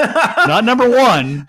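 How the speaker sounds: high, loud, slow, laughing; laughing punchline. Real, not acted. Punchline about the surveillance van, laughing. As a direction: deliver it through laughter.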